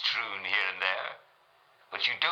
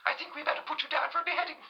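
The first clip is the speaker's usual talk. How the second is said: much higher, haughty royal lady; Queen Mary; faster than usual. Acted. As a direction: ask it like a queen looking down. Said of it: "Her Majesty Queen Mary".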